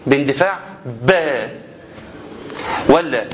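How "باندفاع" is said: باندفاع pushed out hard and loud; forceful burst. Shown as a demonstration. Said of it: "أخرجه بقى يا جماعة باندفاع".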